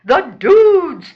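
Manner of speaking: high, loud gang cheer; shouting in unison. Acted. Three rats answer their rallying cry, shouting. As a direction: shout it like a group motto. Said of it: "the three rats shout in unison".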